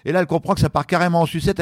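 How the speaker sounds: ordinary talk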